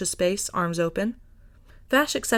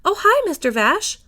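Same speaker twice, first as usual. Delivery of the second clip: high, bright, louder greeting; Millie voice; 11 semitones higher, 6 dB louder than usual. A narrator's reading. Shop owner greeting an old friend, high and bright. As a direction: beam and sing out the hi.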